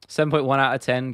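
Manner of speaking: ordinary talk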